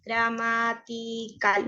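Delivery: drawn out, low correcting the word; slow self-correction. Real, not acted. Teacher correcting the board to her class, very slow and low. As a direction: stretch the word out as you fix your slip. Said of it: "Menos mal que me di cuenta".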